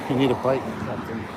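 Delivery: ordinary talk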